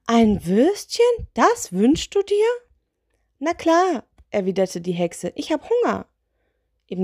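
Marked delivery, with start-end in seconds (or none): 0.1-2.5 slow, louder, pitch soaring in disbelief; incredulous. Acted. Spirit repeats the sausage wish, utterly incredulous. 3.4-4.0 high, breezy little witch. Acted. Witch confirms her wish, high little voice. 5.3-6.1 high little witch voice. Acted. Witch explains the sausage, high little voice.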